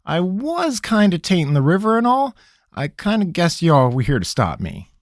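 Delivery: a cornered trickster pleading; nervous country rogue. Acted. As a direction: squirm and stall, talking fast. Pickett voice.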